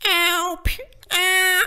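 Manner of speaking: very high-pitched cartoon wife; high animated housewife impression; Lois Griffin. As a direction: yelp in a high cartoon-mom voice. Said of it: "I can do is Lois Griffin".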